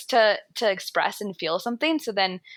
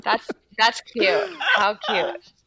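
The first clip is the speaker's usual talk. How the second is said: laughing cute; 2 semitones higher, much slower than usual. Real, not acted. Cat photo, laughing.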